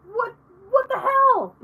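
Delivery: high, drawn-out mock disbelief; imitating someone losing patience; mock exasperation. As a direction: pitch it up in exaggerated exasperation. Imagined exasperated celebrity snaps at rambler.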